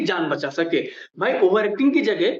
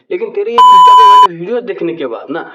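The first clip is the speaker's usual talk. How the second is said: angry rant; 10 dB louder than usual. Real, not acted. Loud rant.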